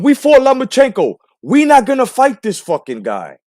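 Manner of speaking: hammed-up dismissive dad; mock Lopez Senior. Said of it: "He said".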